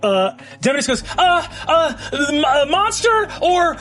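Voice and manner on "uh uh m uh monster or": high, stammering fluster; acted panic. Game master as Demodus choosing a target, panicked and high.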